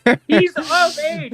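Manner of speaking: loud correction. Real, not acted. Correcting underage joke.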